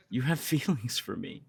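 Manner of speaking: a laugh breaking through mid-line; giddy, half laughing it out; laughing in disbelief. Acted. Learning the love is mutual.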